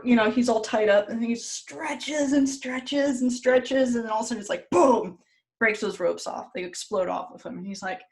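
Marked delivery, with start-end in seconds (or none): 1.3-4.0 slow, higher, stretched-out words; drawn-out strain. Retold or imitated. Storyteller showing the wolf pulling at ropes, drawn-out strain. 4.7-5.2 loud, higher burst on the word; explosive boom. Retold or imitated. Storyteller as the ropes burst off, explosive boom.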